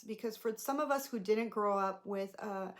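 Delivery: normal talking voice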